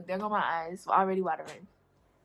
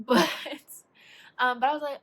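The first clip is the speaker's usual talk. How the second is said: ordinary delivery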